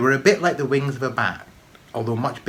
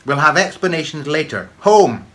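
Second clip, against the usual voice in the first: higher, louder furious mum; angry shout; 5 semitones higher, 9 dB louder than usual. A narrator's reading. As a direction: snap it, then yell home. Mum cuts Tam off.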